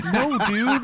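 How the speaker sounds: high laughing no dude; amused exasperation; laughing retort. Retold or imitated. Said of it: "And it's like".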